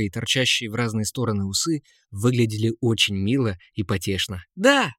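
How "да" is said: bright, high, loud exclamation; ringing yes. A narrator's reading. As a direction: blurt it out eagerly. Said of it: "звонко ответил принц".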